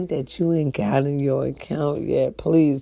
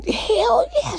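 mock reply; 5 dB louder than usual. Mocking voice answering herself.

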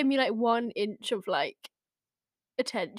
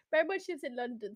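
ordinary delivery

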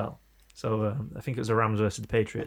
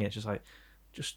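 ordinary delivery

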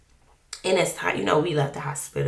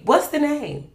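higher, insistent what's the name; impatient demand; 9 semitones higher, 4 dB louder than usual. Retold or imitated. Wife demanding the name from her husband, insistent. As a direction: press him hard for it. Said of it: "I'm struggling".

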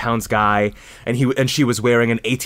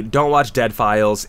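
normal talking voice

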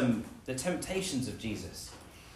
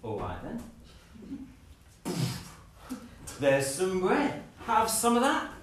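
offhand start building to a loud, higher boast; mock bravado that builds to a loud, higher crow; cocky show-off; 7 semitones higher, 4 dB louder than usual. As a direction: toss it off, then show off hard. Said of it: "I'd be like".

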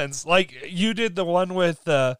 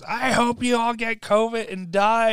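imitated angry yelling; 6 semitones higher than usual. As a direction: imitate a woman cussing people out. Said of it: "she's yelling at him".

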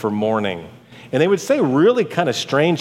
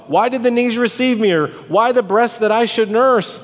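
higher, dramatized lamenting question; anguished plea; 7 semitones higher than usual. Acted. Preacher voicing Job's complaint to God, anguished plea. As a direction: cry it out as a complaint.

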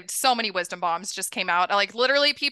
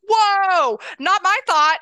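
sharp, much higher and louder whoa; startled; 10 semitones higher, 11 dB louder, much slower than usual. Retold or imitated. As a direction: recoil in shock at the thought. Reacting to a hideous thought.

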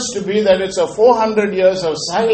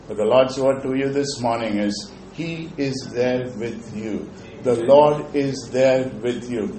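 tender assurance; 6 semitones lower, 4 dB quieter than usual. Acted. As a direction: lay it gently on them. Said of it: "quiet assurance to congregation".

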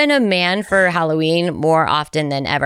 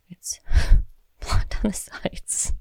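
laughing whisper; slower than usual. Real, not acted. Laughing at the wig.

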